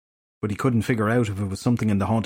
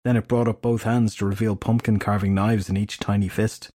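usual speaking voice

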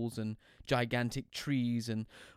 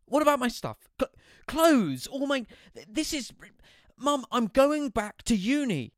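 higher, louder, stumbling protest; flustered protest; 13 semitones higher, 9 dB louder than usual. A narrator's reading. Son protesting to Mum, higher and louder. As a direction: splutter like a cornered son.

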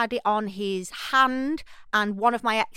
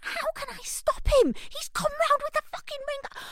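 panicked mum; 3 dB quieter than usual. Retold or imitated. Mum hiding under the bed, high and frantic. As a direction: squeal it like a frantic mother.

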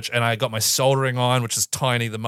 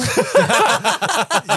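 burst of laughter; 4 dB louder than usual. Real, not acted. Laughing at Ellie's reaction.

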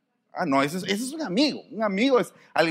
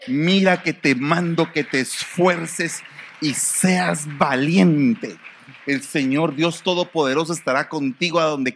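preaching; 5 dB louder than usual. Acted. Preaching scripture at full force.

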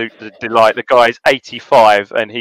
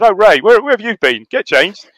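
imitating Alf Ramsey; 8 semitones higher than usual. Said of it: "he said, Oh, Alf Ramsey said".

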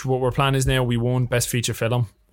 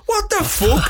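stunned shout; slower than usual. Retold or imitated. The lads in the circle hear they won, shocked shout. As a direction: blurt it out, totally floored by the win. Lads react to winning award.

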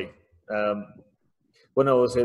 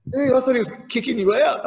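higher, louder put-on voice of a classmate; mock the classmate's teasing, higher and louder; Canadian classmate; 13 semitones higher, 6 dB louder than usual. Canadian classmate teasing him on his return, high and loud.

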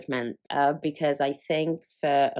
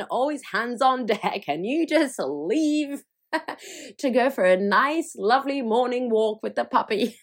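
higher, laughing mock rant at her husband; imitating her own outburst at George, higher and louder; retold rant; 6 semitones higher, 3 dB louder than usual. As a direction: ham up the complaint, half laughing.

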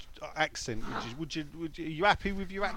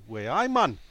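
put-on Geordie exclamation, swooping up; Geordie accent; 5 dB louder than usual. Showing off the accent he'd choose, Geordie. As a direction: call it out in mock Geordie. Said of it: "sort of Geordie and support Sunderland".

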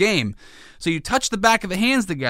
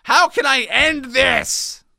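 exasperated parent; 10 semitones higher than usual. Retold or imitated.

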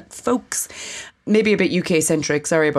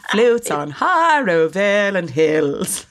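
slow, reedy recital voice; lightly hammed-up schoolchild poem reading; elocution voice; 3 semitones higher, slower than usual. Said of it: "your elocution voice".

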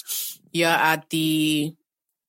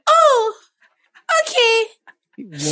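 very high, loud put-on voice; silly high voice; 5 dB louder, much slower than usual. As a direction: squeak it out like a cartoon. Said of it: "why are you talking like that?".

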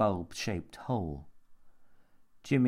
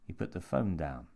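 ordinary delivery

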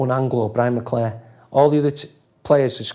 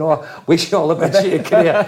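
higher, louder laughing send-up of a gruff manager; mock Sir Alex; 5 semitones higher, 6 dB louder than usual. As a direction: take the mickey with a laugh as a hard-nosed boss. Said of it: "couldn't imagine Sir Alex ringing me up and saying".

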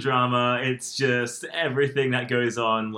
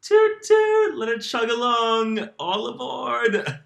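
high toot-toot train call, then a slow all aboard; train conductor; 10 semitones higher, 4 dB louder than usual. Pretend. Joking about the train of thought, as a high mock conductor. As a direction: play a chugging train for fun.

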